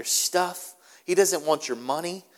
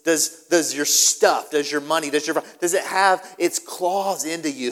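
normal talking voice

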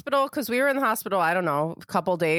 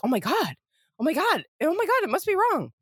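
rising from plain to higher, more alarmed with each "Oh, my God"; growing mock panic; 6 semitones higher than usual. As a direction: start calm, then climb in pitch as the worry builds. Voicing a panicking new mother.

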